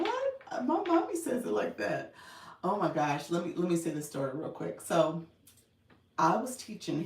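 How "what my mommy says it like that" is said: put-on five-year-old son. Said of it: "he's gonna be like".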